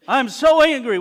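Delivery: imitating a bitter churchgoer; mock anger. Voicing a resentful churchgoer.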